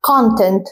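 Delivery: CONtent, first syllable punched; stress on CONtent. Shown as a demonstration. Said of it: "هتركز على أول جزء من الكلمة".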